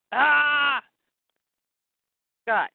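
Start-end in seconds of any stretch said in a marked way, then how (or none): 0.1-0.8 drawn-out mock yell; fight cry. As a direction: yell briefly as a guard in battle. Guard yells during acted fight.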